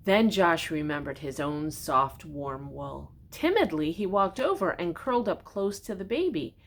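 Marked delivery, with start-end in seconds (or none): none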